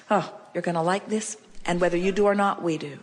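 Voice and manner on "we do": ordinary talk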